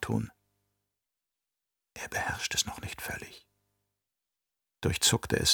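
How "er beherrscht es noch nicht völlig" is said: unvoiced, very soft inner whisper; hushed inner voice, barely audible; whispered thought. A narrator's reading. Inner thought of anxious mother.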